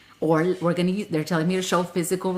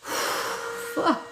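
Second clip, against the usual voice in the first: unvoiced tired swear; breathy worn-out curse; 13 semitones higher than usual. Real, not acted. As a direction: breathe it out spent. Exhausted after channelling.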